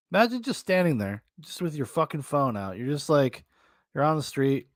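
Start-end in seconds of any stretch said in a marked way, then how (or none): none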